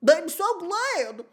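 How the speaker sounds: put-on high, loud, drawn-out baby voice. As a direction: squeal it slowly like a creepy baby. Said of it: "I'm gonna keep this voice".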